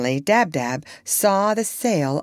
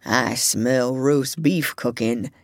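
lower, slow dreaming dog mumbling; sleepy mumble; 6 semitones lower, slower than usual. A narrator's reading. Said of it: "he mumbled".

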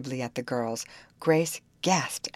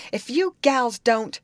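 high, louder, slow threat; blustering; 9 semitones higher, 6 dB louder, slower than usual. A narrator's reading. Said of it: "blustered the second man".

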